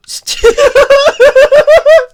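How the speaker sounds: loud high helpless laughter; cracking up at the game; burst of laughter. Real, not acted. Gamer cracking up at the game, laughing hard.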